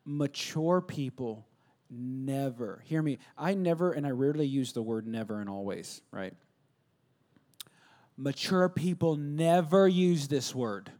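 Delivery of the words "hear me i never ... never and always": normal talking voice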